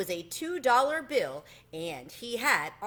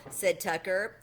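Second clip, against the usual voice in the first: ordinary delivery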